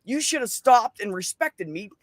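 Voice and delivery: high-pitched retold telling-off; indignant reproach. Telling off the coworker who should have respected them, indignant.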